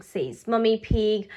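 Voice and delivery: ordinary delivery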